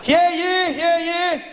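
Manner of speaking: high, pompous British herald; pompous fussy courtier. Acted. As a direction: proclaim it like a royal herald, slowly. British soldier proclaiming arrival.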